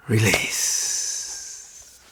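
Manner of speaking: soft breathy release, falling; whispered release. Shown as a demonstration. Teacher cueing release in a soft whisper. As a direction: let it melt out on a whisper.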